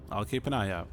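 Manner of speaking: ordinary talk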